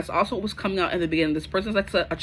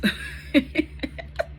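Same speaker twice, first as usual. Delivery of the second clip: a high airy burst of laughter; quick amused giggle; breathy laugh; 8 semitones higher than usual. Real, not acted. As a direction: laugh it off, half embarrassed. Laughs at possessive message.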